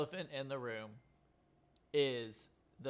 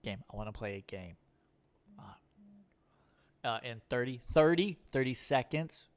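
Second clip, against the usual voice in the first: halting stammering Jigsaw; Jeff Goldblum; 6 dB louder than usual. Retold or imitated. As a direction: stammer through it, hesitant. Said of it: "Jeff Goldblum to be the Jigsaw voice".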